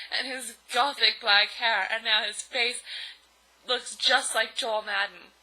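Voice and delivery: ordinary delivery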